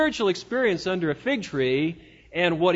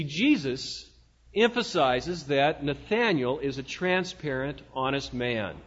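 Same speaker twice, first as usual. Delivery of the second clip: normal talking voice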